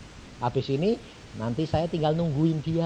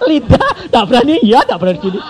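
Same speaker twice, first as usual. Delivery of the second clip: loud mock stammer; 8 semitones higher, 13 dB louder than usual. Acting tongue-tied fear.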